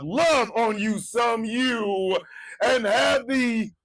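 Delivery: slow, high shouted pep talk; hype shout. Real, not acted. Host hyping up viewers, slow high shout. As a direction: pump them up, stretching each word.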